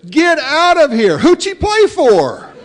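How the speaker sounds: high, loud, slow incredulous retort; mock outraged young man. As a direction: blurt it out in disbelief, way up high. Young man's punchline retort.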